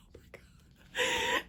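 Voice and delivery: high, slow breathless whisper; awed whisper. Retold or imitated. Reader showing her friend how she reacted, high slow whisper. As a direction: whisper it clutching the book. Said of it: "and I'm, like".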